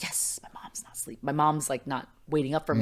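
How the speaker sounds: hushed, breathy sneaking-in whisper; relieved whisper. Retold or imitated. Teen sneaking home whispers relief to herself. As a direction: whisper so nobody wakes up.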